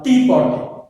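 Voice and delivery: "tea potty" with TEA punched loud; first-syllable stress. Shown as a demonstration. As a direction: hit "tea", let "potty" fall. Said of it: "Stress falls on the first syllable".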